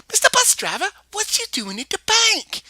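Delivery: put-on high, loud child calling out; delighted to spot him; excited greeting. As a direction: kid thrilled to see the bus driver. Child greets bus driver excitedly.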